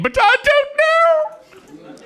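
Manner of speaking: high, slow whimpering Laurel voice; Stan Laurel as Prissy. Acted. Comic as Laurel-Prissy, high slow whimper. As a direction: drag it out in a high crying whine. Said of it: "I'll do Stan Laurel as Prissy the maid".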